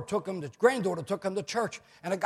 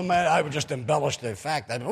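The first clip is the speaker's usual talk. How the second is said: ordinary delivery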